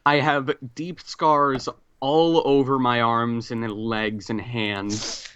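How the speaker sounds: usual speaking voice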